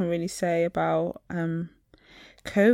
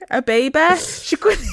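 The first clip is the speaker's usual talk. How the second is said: higher, louder exaggerated Cheshire accent breaking into a laugh; mock Cheshire baby; 8 semitones higher, 4 dB louder, slower than usual. Mocking Cheshire colleague, higher and louder, laughing at herself. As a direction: overplay the word baby, laughing. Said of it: "the way I said baby".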